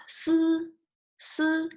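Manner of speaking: "Si" high and level, hissed s into held vowel; si with e sound. Shown as a demonstration. Teacher showing students the vowel sound, si with e sound. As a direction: hold "Si" evenly after the s. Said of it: "have this e sound".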